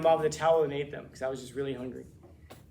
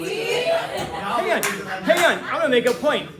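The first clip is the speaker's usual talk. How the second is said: high, loud hang on to stop everyone; urgent classroom interruption; excited hang on; 10 semitones higher, 10 dB louder, slower than usual. Real, not acted. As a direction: jump in loudly to halt the class. Teacher stops class, excited.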